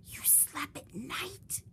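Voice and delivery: whispered gasp, high and slow; high slow whispered shock. Acted. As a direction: breathe it out astonished. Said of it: "gasped another".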